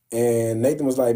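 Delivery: ordinary talk, nothing marked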